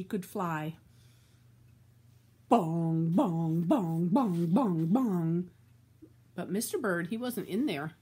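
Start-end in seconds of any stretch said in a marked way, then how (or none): 2.5-5.5 low, quick, loud bell bongs. Acted. Reader rings the bell again, quick and loud. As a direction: clang them out fast like the bell.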